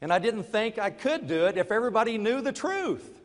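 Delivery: usual speaking voice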